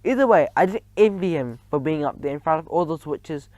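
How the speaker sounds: usual speaking voice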